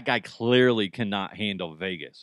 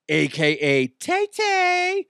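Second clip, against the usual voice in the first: mock fangirl; 5 dB louder, slower than usual. Host mocks the fan nickname in a much higher slow coo.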